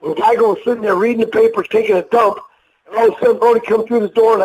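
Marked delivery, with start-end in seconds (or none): none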